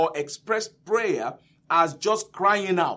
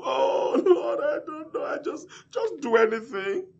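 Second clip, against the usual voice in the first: high, fake-tearful wail; mock whining; 7 semitones higher than usual. What the blind man didn't say, mock whining. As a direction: put on a helpless crying plea. Mocking a helpless, wailing prayer.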